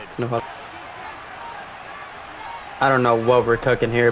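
ordinary delivery

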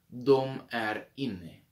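"De är inne" spoken very slowly, word by word; slow model sentence. Shown as a demonstration. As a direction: spell out "De är inne" for learners. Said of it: "slow model sentence". (frase lenta "De är inne")

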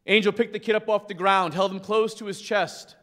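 usual speaking voice

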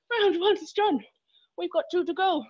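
excited. Real, not acted. Cheering end of round.

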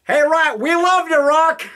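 mock a drunk fan calling at 2am; imitating drunk fans. Late-night prank caller gushing at the actor, hammed-up imitation. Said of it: "They would call me up late night and say".

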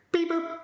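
high beep boop; button beep. Retold or imitated. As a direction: chirp it like a buzzer. Imitates call button.

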